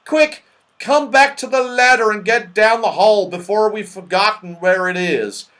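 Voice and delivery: high, loud, pressing call; urgent warning. A narrator's reading. Moonface warns all back to the ladder, urgently. Moonface urges retreat in danger.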